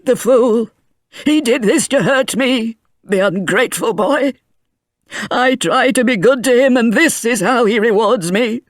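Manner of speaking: put-on high, aggrieved old man ranting; an old man wounded and lashing out; bitter old grandfather. A narrator's reading. Grandfather complaining about his ungrateful grandson, bitter rant.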